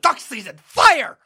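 high, loud squawking duck voice; Daffy Duck impression. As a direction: shout it like a cartoon duck. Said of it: "give us your best Daffy Duck".

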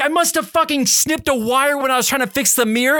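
high, agitated retold rant; exasperated rant. Driver retelling his fury at the broken window, exasperated. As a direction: rant in frustration, pitched well up.

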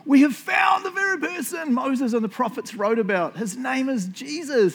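high-pitched, hammed-up announcement; excited proclamation. Philip telling Nathanael of the found Messiah, acted excitement. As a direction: burst out with amazing news, voice way up high. Acting out Philip's breathless news.

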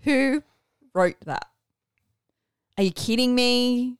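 rising, loud mock outrage; escalating comedy rant. Mock outrage over line.

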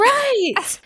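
high, loud exclamation of agreement; real burst of excited validation; emphatic agreement. Real, not acted. Co-host agreeing about the cuddling siblings, excited.

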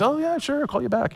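son-in-law mocking father-in-law, high breezy voice; mocking impression. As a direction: tease him with a light airy dodge. Mocking father-in-law's phone dodge.

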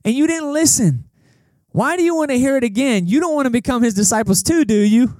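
high-pitched, mock-exasperated questioning; sarcastic. Healed man needling the Pharisees, sarcastic and frustrated. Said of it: "That's straight sarcasm".

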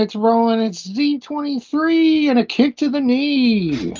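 high, sing-song number calling; bingo caller. Acted. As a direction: call it like a bingo hall. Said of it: "working on being a bingo caller".

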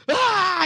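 high, loud retold scream; mock scream. Imitated kid's scream of pain. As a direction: brother mimics his twin hurt by the toy sword, screaming. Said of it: "just starts screaming".

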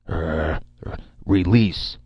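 strained, halting word; violent effort. A narrator's reading. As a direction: force the word out after a grunt. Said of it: "said with a violent effort".

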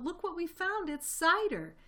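very high excited cry; cried out. A narrator's reading. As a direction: announce your find in high-pitched glee. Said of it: "he cried out".

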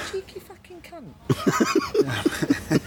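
high, very slow, laughing through it; laughing outrage. Retold or imitated. Recalling thought about queue jumper, slow laughing outrage. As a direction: drag it out laughing in mock fury.

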